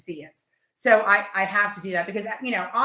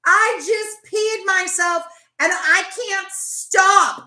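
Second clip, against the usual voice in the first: slow, louder, laughing through the words; laughing mom; 6 semitones higher, 6 dB louder, slower than usual. Retold or imitated. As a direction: imitate a helpless laughing mother. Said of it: "And she's like".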